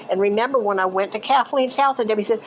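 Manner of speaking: ordinary talk, nothing marked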